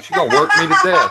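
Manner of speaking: high laughing mock complaint. Real, not acted. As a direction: laugh through the joke.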